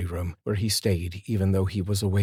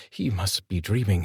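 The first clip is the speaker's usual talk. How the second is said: usual speaking voice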